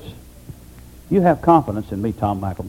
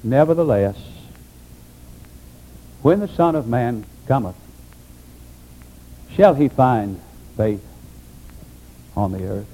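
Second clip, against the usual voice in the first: sorrowful Jesus; 5 semitones lower, 5 dB quieter than usual. Retold or imitated. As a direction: let each phrase sink with sorrow. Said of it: "something like this".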